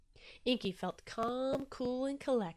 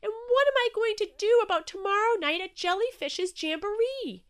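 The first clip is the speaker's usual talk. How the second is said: anxious worry; 11 semitones higher, 8 dB louder than usual. Acted. Inky anxious after inking.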